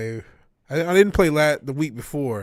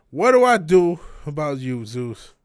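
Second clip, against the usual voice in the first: exasperated; 9 dB louder than usual. Real, not acted. Exasperated at the dog.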